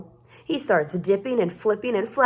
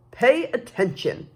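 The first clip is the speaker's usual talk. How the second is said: stern teacher; 3 semitones higher than usual. Acted. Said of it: "snaps Maestro".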